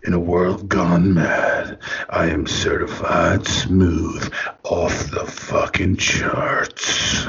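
very low, slow trailer voice ending in a whisper; deep epic trailer read, fading to a breathy whisper; movie trailer voice. Shown as a demonstration. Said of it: "Then you gotta say".

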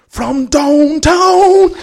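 high, loud, slow stretched shout of downtown; commentator shout. Retold or imitated. Cork commentator shouting at a club final, stretched high yell. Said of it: "in his Cork accent shouting".

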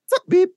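high-pitched bleep noise; tight bleep. Retold or imitated. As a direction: swear word cut by a high imitated beep. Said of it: "the bleeps I remember ... were so tight".

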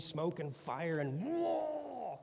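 slow, drawn-out mock grandeur; mock epic awe. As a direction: stretch it like a cheesy epic. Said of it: "that whole movie-like thing".

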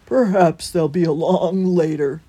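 loud, rounded character voice; encouraging hedgehog. A narrator's reading. Holgate encouraging sad Christopher, loud hedgehog voice. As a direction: encourage him in a big voice. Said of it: "encouraged the hedgehog".

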